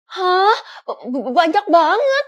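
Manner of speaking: slow, stammering disbelief; stutter, then stretch it out loudly; awestruck, loud and drawn out; stunned amazement. Acted. Stunned by the teacher's balance.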